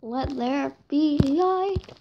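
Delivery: slow, grand proclamation; mock-solemn proclamation. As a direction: announce it like a miracle. Grand line placing first torch.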